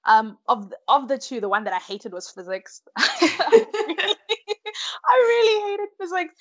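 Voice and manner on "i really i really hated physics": high, squealing laugh through the words; loud, giggly high-pitched emphasis. Real, not acted. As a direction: burst out laughing while confessing, pitch soaring. Laughs while confessing dislike of physics.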